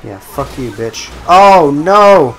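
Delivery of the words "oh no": alarmed cry. Real, not acted. Sudden setback in game.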